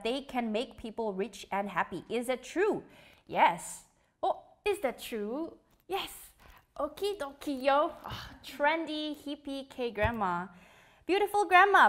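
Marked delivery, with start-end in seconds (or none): none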